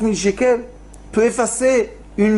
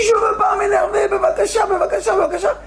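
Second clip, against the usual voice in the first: desperate shouted prayer; shouting plea; 12 semitones higher, faster than usual. Retold or imitated. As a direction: call out to God, begging. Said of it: "Et il crie".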